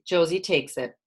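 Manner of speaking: usual speaking voice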